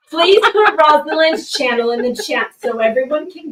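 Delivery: high, loud laughing plea; asking the chat while cracking up. Real, not acted. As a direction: laugh your way through the request. Laughing through request.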